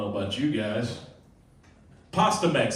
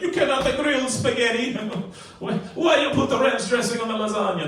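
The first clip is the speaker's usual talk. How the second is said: high, louder mock outrage; hammed-up indignant protest; irritated Italians; 10 semitones higher, 4 dB louder than usual. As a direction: act out an offended Italian, pitched way up. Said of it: "They're gonna be immediately irritated".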